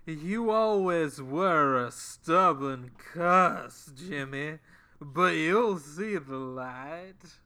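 slow, drawn-out Southern drawl; Southern accent. Acted. Podcast host doing McCoy's Southern accent to Kirk. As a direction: drawl it slow like a Georgia country doctor. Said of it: "[name] and [name] attempt to do Southern accents".

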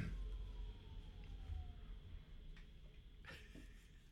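rapid string of high yapping barks; yappy little dog. Pretend. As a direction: yap like a tough little terrier. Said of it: "like, tough, like, small dog".

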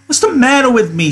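high-pitched indignant outburst; angry cry. A narrator's reading. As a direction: cry it out as an angry woman character. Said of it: "Her face grew angry".

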